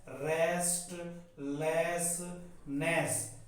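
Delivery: dictation-slow "restlessness", piece by piece; syllable by syllable. Shown as a demonstration. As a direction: break "restlessness" into three parts with pauses. Teacher breaks word into syllables.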